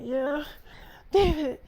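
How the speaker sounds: high, softened lover's reply; lightly voiced eager lover, high and soft.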